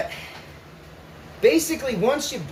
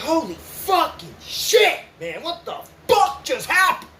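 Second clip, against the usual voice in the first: shocked shout; 5 semitones higher than usual. Retold or imitated. After the noose comes off, shouting in shock. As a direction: blurt it out in sheer shock. Jumping up in terror.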